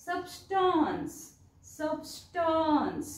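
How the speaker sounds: सबस्टन्स said very slowly, syllable by syllable, stress on स्टन्स; सबस्टन्स pronounced slowly with the a sound kept; pronunciation demo. Shown as a demonstration. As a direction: draw out सबस्टन्स slowly, every sound clear. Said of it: "याचा उच्चार कसा करायचा आहे?".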